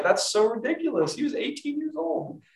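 incredulous. Retold or imitated. Preacher scoffs at a teen relationship guru, incredulous. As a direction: squeak it out in disbelief. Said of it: "I'm like".

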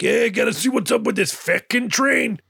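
lightly voiced high swaggering tough guy; careless train owner. Train boss shrugging off trouble, high mock swagger. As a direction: brush it off like a cocky boss. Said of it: "who's like".

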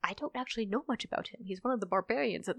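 normal talking voice

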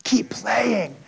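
hushed, unvoiced whisper. Pianist whispering encouragement to the boy beside him, whisper. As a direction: whisper it gently, close by. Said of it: "and he whispers".

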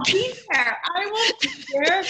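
high, mock-chirpy "oh great", dripping sarcasm; sarcastic fake cheer. Mother answering the daycare's call about her sick son, sarcastic sing-song. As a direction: sing it out brightly while meaning the opposite.

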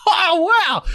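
high, stunned oh wow; mock amazement. Neighbour hearing she's Marcus's girlfriend, high mock amazement. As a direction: play his stunned reaction. [name] reacts to news.

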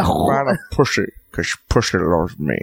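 low, slow character impersonation; the dragon. Acted. Comic showing his one impersonation, low slow dragon voice. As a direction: drop low and draw it out in character. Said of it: "I told you the impersonation I do".

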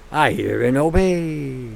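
low, slow, soft submission; drawn-out entranced reply; obedient servant. Acted. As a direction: intone it slowly, as if mind-controlled. Acts out the obedient, controlled person.

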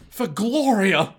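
drawn-out heroic yell; battle cry. Pretend. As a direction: cry it out for the fallen.

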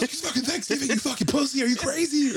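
very high, whiny mock outrage; mock crying taunt. Taunting the driver who chased him, mock wailing. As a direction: wail it like a fake crybaby.

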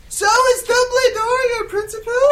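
very high, loud shout. Acted. New student questioning Satan about the principal, shouted. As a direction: yell the question at full pitch. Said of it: "I shouted".

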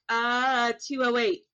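ordinary talk, nothing marked

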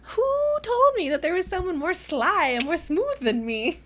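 high, smug mock outrage; smug charmer. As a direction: play the smooth smug prince. Kai voiced as smug rival.

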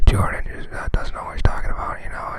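voiceless stage whisper. Real, not acted. Co-host talking behind the host's back, whispering. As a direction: whisper it as a sneaky running commentary. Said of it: "I'm muting all the whispering".

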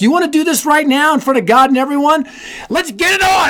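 high, heated challenge, pitch climbing; mock fighting words. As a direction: throw down the gauntlet in a retold quarrel. Retold fight with wife at church.

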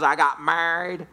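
slow retold punchline; lady in story. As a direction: lightly imitate the lady, drawing out the words. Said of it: "She says".

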